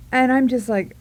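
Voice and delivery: ordinary delivery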